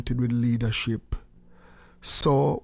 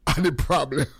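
ordinary talk, nothing marked

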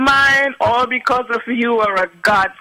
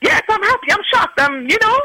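excited; 12 semitones higher than usual. Retold or imitated. Retold shocked reply to teller.

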